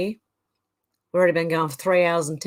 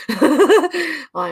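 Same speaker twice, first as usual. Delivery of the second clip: laughing; 8 semitones higher, 5 dB louder than usual. Real, not acted. Laughing while reading.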